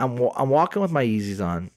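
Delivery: usual speaking voice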